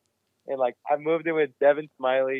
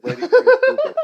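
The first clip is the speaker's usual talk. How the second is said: high, loud burst of laughter on the words; real laughter breaking the line; laughing; 7 dB louder, faster than usual. Real, not acted. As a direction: crack up while finishing the punchline. Laughs at the punchline.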